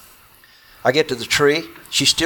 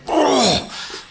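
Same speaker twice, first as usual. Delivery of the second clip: imitated bear roar; 13 semitones higher than usual. Bear roars again mid-story.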